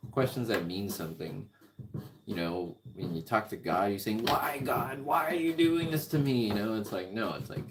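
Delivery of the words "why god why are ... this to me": higher, rising, pleading to God in mock despair; hammed-up wail of why me; mock whiny plea. Mocking someone pleading with God.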